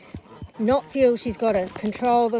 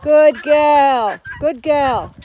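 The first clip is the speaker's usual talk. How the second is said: loud praise; 3 semitones higher, 8 dB louder, much slower than usual. Real, not acted. Praising dog loudly.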